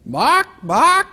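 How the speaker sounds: two high clucked bocks; chicken clucking twice. Acted. Chicken back at the librarian's desk, high double cluck.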